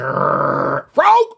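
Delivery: growl, then blast the word out; boy's growling tic with a loud yelp. Retold or imitated. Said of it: "He said".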